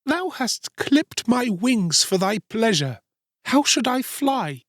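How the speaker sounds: very high, slow bird voice; reproachful linnet. A narrator's reading. Maimed linnet answering the boy, reproachful. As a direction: draw out a high, plaintive reproach.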